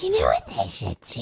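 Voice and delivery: very low, rasping witch voice; witchy voice. Acted. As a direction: croak it like an old witch. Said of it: "Do your witchy voice".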